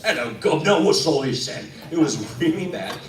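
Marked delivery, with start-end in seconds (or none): 0.0-1.7 loud, hammed-up Cockney; terrible Cockney accent. Weasel villain greeting the play's audience, terrible Cockney. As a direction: do a really bad stage Cockney. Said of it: "we spoke with terrible Cockney accents".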